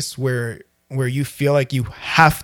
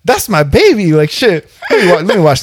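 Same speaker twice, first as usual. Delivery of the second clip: higher, louder excited exclaim; 3 semitones higher, 5 dB louder than usual. Retold or imitated. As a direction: blurt it out, thrilled. Hyped reaction in the shower.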